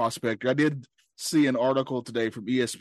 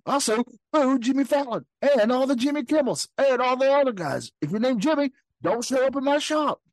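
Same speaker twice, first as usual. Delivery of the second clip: high, yelling jock voice; brash, mocking pitch; high character voice; 11 semitones higher than usual. Continues the Rodgers bit, louder.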